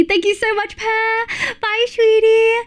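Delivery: high, sweet sing-song thanks; cute anime streamer. Real, not acted. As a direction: coo a goodbye like a cutesy streamer. Sweet farewell to raider.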